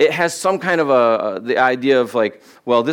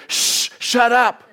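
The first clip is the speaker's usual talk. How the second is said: high, drawn-out mock hush; impatient shushing; 10 semitones higher, slower than usual. Preacher imitates the crowd a second ago, impatient shushing. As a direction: shush and silence them like an annoyed crowd. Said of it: "they were like".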